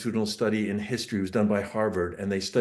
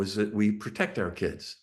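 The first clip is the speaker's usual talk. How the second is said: ordinary delivery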